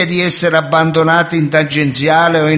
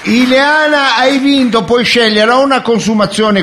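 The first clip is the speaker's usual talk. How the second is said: excited host; 6 semitones higher than usual. Acted. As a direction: announce the win with glee. Annuncio della vincita. (annuncio entusiasta da presentatore)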